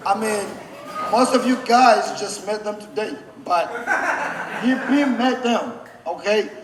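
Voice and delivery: can't stop a laugh mid-sentence; laughing while pointing out friends. Real, not acted. Laughing while addressing the crowd.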